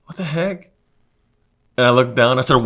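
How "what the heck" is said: high, sudden what-the-heck; spooked double take; startled disbelief. Retold or imitated. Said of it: "I'm like".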